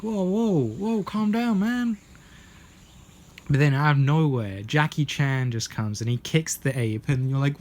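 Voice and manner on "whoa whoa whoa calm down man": high-pitched mock alarm; pleading to calm down. Bystander to the rampaging ape, high mock pleading. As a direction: imitate someone nervously talking down an animal.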